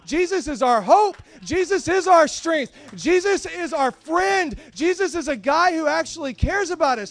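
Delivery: loud, ringing pulpit shout; fiery sermon peak, shouting Peter's plea; full-force preaching. Acted. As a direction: preach it out to the back pews. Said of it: "I'm in the middle of preaching right now".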